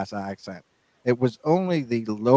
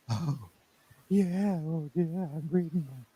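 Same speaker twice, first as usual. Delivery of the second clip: soft, higher-pitched seductive purr; mock sexy voice; 6 semitones higher, 7 dB quieter than usual. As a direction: put on a hushed, sexy voice. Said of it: "if you do the sexy voice".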